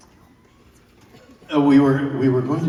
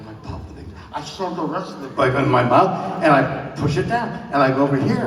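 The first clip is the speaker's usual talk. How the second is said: ordinary talk, nothing marked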